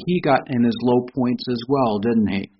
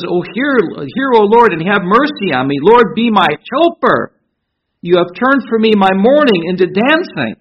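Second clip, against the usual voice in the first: raised, ringing voice lifting the psalm to the congregation; fervent scripture reading; 4 semitones higher, 4 dB louder than usual. Acted. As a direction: proclaim each verse out loud like praise. Reads psalm aloud with fervour.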